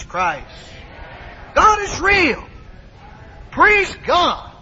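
high, emphatic pulpit exhortation, climbing to a shout; fervent preaching. Real, not acted. Preacher urging excitement over Jesus Christ, fervent preaching. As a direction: cry it out to stir the congregation.

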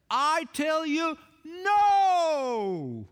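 booming, high-pitched cry with a long drawn-out NO; emphatic shout. Shown as a demonstration. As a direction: shout "I tell you", then stretch "no!" long and loud. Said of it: "That's the emphatic position".

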